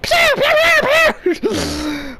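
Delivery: high playful pew pew; mock laser gun sounds. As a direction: make toy-gun noises, first ones high. Imitating gunfire while shooting.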